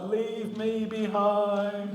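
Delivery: slow, dramatic plea to be left; hammed-up, drawn-out noble sacrifice; war movie hero. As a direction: beg like a dying soldier in a war film. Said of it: "it was like a war movie".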